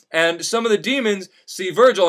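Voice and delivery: ordinary delivery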